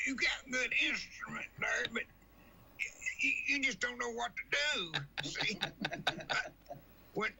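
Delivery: a mock old woman chiding the singer; impression of the old lady. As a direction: lecture him in her fussy voice. Voicing Miss Walker's advice.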